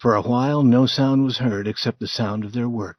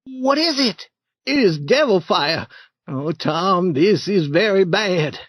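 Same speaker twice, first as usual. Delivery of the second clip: a hint of a scared boy, raised a bit; a boy spooked by a light; lightly voiced Huck, frightened; 7 semitones higher, 4 dB louder than usual. Huck seeing devil fire, mildly raised scared boy.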